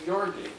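"Gyarding" with a y after the G, gyar-ding; local pronunciation. Shown as a demonstration. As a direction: say "Gyarding" the island way, G then y. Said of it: "as if it were spelled G Y A R I N".

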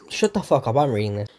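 ordinary delivery

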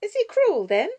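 put-on high, loud little-girl voice; questioning child. Six-year-old asking about the budgie man, questioning child voice. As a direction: ask it like a small child.

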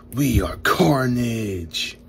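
slow, drawn-out villain line; Carnage. Pretend. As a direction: stretch it out like a comic monster. Voices the Carnage figure.

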